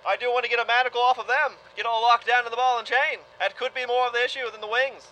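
ordinary talk

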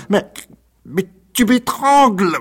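choking terror. A narrator's reading. Old woman cries out as Rocambole strangles her, terrified. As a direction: gasp it out while being choked.

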